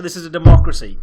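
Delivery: mock-outraged shout. Host protesting being cut from segment, mock-outraged shout. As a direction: belt out a playful objection.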